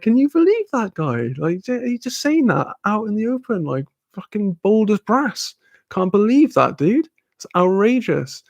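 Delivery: high-pitched incredulous outrage; retold indignant disbelief. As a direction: re-enact venting to friends, pitched well up.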